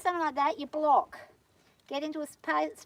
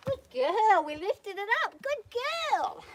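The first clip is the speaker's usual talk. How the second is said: much higher and louder, delighted; excited praise; 8 semitones higher, 5 dB louder, slower than usual. Real, not acted. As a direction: cheer the mare on for a big step. Praising horse after a breakthrough.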